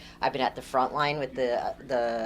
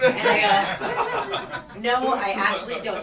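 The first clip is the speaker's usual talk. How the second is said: laughing; 6 semitones higher, 5 dB louder, much slower than usual. Real, not acted. Laughing through the story.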